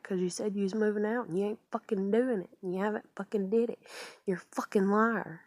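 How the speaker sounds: mock tirade at grandmother; going off on her. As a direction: act out telling someone off, loud and rushing. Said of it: "just going off on her".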